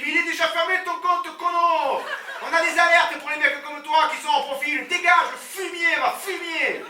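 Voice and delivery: high, loud furious yelling; furious banker. Retold or imitated. Banker throws the broke client out, furious shout.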